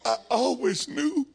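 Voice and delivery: high-pitched, tearful, hammed-up gushing; Myrtle. Preacher acting out a woman fawning over Jethro, high and tearful. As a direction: gush in a high, weepy put-on voice. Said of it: "here comes Myrtle".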